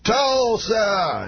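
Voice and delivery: high, drawn-out stage call; Bill Cosby. Retold or imitated. Star calling to the stadium crowd, high drawn-out imitation. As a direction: stretch both syllables to the crowd. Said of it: "every time slower".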